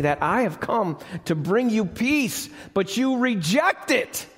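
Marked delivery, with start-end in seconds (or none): 2.7-4.3 impassioned crowd voice. Retold or imitated. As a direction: demand a conquering king. Voicing the crowd's demand.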